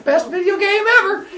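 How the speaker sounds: high, loud gleeful cheer; gamer hype. Acted. Player chopping his father in Mario, cheering. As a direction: shout it like a thrilled kid.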